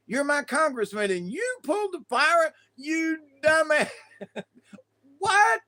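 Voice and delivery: high, incredulous, laughing outrage; mock outrage. Constituent confronting the congressman, mock outrage. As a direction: build to a high-pitched, disbelieving cry.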